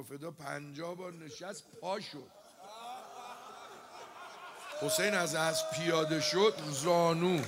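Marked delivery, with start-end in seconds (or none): 4.8-7.4 starts plain, swells to a full shout; mourning recital building to a shout; building lament. Acted. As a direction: let the grief climb to a cry. Narrates Hussein's fall, rising.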